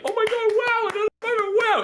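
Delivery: squealing high, amazed; amazed crowd. Retold or imitated. Retelling the audience's reaction, squealing high. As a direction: gasp like a stunned fan. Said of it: "We're all just like".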